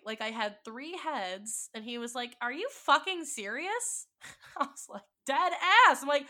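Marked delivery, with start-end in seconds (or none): 2.4-4.1 high, loud disbelieving question; incredulous. Retold or imitated. He reacts in disbelief. 5.3-6.0 loud, high insistent blurt; emphatic. Retold or imitated. Insisting while sobbing.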